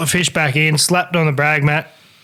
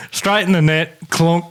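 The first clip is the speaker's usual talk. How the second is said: usual speaking voice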